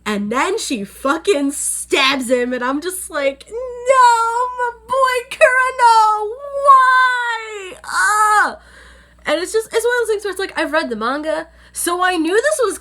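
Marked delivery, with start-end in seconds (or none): 3.5-7.8 high, loud wailing no, slowed down; anguished cry. Retold or imitated. Vlogger reliving the stabbing of her favourite, wailing. 7.8-8.6 anguished wail. Real, not acted. Reacting to the stabbing.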